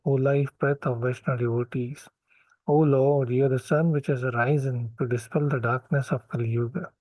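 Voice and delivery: usual speaking voice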